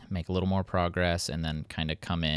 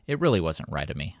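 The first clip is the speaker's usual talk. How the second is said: ordinary talk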